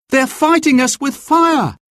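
high-pitched, urgent character cry; alarmed cry. A narrator's reading. Will spots smoke and warns his friend, crying out in high alarm. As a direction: cry out a warning, pitched well up. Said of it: "Will cried".

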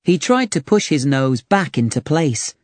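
plain reading voice

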